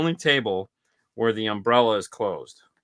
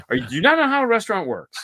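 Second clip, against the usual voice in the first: exasperated; 10 semitones higher, 5 dB louder, faster than usual. Retold or imitated. Retold exasperated jab at mom.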